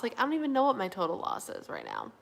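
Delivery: ordinary talk, nothing marked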